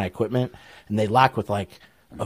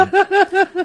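loud high laugh; 7 dB louder than usual. Real, not acted. Laughs at own story.

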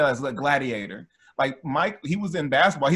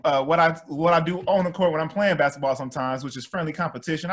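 ordinary talk, nothing marked

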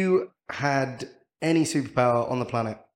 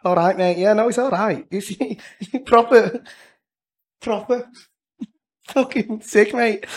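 put-on high-pitched Scouse accent; Jamie Carragher; 8 semitones higher than usual. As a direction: imitate high Scouse, half laughing. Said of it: "like, Jamie Carragher Scouse".

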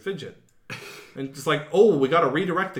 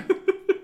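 high laugh. Real, not acted. Laughs after queen bit.

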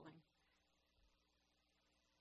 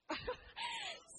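laugh; 4 dB louder than usual. Real, not acted. Laughing at corn joke.

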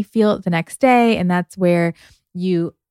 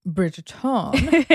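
ordinary delivery

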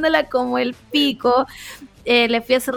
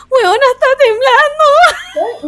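much higher, louder, slow, near tears; panicked insistence; 10 semitones higher, 7 dB louder, slower than usual. Retold or imitated. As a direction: insist it's shaking, frightened and pleading. Insists to boyfriend about quake. (grita asustada al novio que está temblando)

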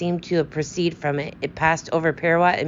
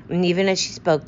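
usual speaking voice

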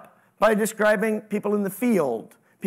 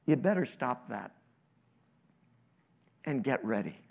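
softer, lower fatherly caution; gentle parental warning; 7 semitones lower, 7 dB quieter than usual. Retold or imitated. God speaking like a good parent to his children, soft warning. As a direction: warn quietly like a patient parent. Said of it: "like a good parent, he's saying".

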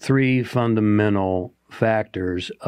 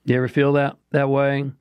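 ordinary talk

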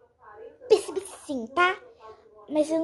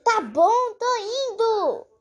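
loud, higher "Tá bom, tô indo", drawn out; louder on request; 7 semitones higher, 3 dB louder, slower than usual. Acted. Told to speak up, she answers her friend loudly. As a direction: raise your voice because she asked. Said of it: "Fala mais alto".

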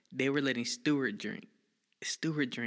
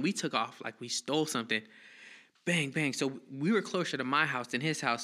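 ordinary talk

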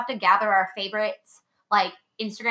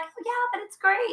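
imitated encouraging mom; 13 semitones higher than usual. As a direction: coo approval like a proud mother, imitated. Retells mom's encouraging reply.

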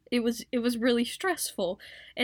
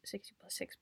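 quick whispered correction; 16 dB quieter than usual. Real, not acted. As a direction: whisper it to yourself.

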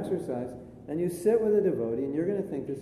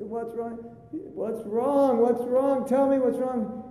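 high, increasingly loud repeated questioning; urgent pleading; 9 semitones higher, 4 dB louder, slower than usual. Acted. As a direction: press a crying man for an answer, voice raised. Head monk presses the crying monk.

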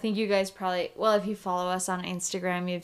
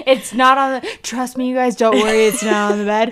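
loud laughing protest; 3 semitones higher, 10 dB louder than usual. Real, not acted. Correcting her co-host about the bed, laughing loudly.

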